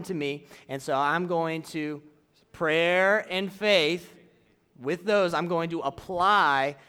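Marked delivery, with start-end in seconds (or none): 2.5-4.1 very slow, stretched-out words; reciting a slogan. Shown as a demonstration. Teacher shows how he answers the devil's whispering, slow recitation. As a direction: draw out each key word deliberately.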